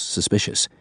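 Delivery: ordinary delivery, nothing marked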